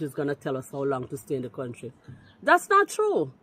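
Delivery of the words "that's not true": sharp high "not true"; indignant denial. Real, not acted. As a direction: shoot the claim down at once. Heated call-in argument.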